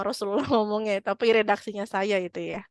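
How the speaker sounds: normal talking voice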